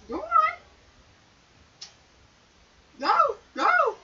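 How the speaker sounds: high urging; high shooing. Real, not acted. Shooing the cat out of her room.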